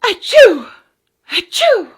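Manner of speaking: two high, loud little sneezes; tiny sneeze. Acted. Cold baby mouse sneezing in the wind, high and loud. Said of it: "the mouseling sneezed".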